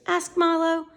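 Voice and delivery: ordinary delivery, nothing marked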